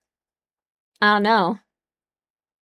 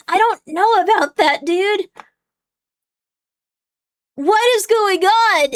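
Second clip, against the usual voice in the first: loud, high, exasperated ranting at chat; outraged rant; 7 semitones higher, 4 dB louder, slower than usual. Real, not acted. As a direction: call it out loudly, fed up.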